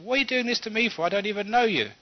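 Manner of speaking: upset protest. Acted. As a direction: protest hotly at a stranger's insults. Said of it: "the husband got so upset".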